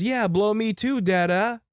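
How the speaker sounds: put-on high-pitched eager little-kid voice; high mock-toddler plea to dad; excited toddler. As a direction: imitate a small boy begging to join in. Second toddler son voiced, excited.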